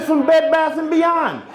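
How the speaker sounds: high, slow mocking son voice; son's voice. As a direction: mimic the kid high and proud. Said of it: "He said".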